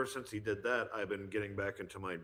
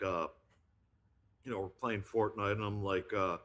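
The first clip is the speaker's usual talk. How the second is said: ordinary talk, nothing marked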